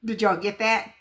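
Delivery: normal talking voice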